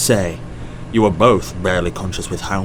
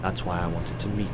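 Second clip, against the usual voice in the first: hushed, sober, lowered voice; quiet judgement; 10 dB quieter than usual. Acted. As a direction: weigh it gently and solemnly.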